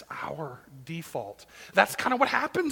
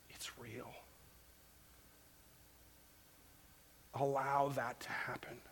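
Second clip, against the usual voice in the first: much softer, sinking to a whisper; intimate appeal; 3 semitones lower, 9 dB quieter than usual. Acted. Preacher inviting listeners to feel Jesus' love, hushed. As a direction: draw them close, whisper it. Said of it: "intimate appeal".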